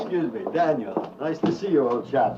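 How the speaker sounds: put-on American faking an upper-class English accent; put-on posh English accent. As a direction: play the English lord in a plummy English accent. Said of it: "Pretend you're me".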